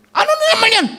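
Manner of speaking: very high, weary "what now"; exasperated. Retold or imitated. The speaker groaning at yet another task, very high and exasperated. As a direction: throw up your hands in disbelief.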